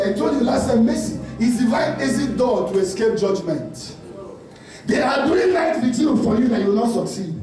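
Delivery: normal talking voice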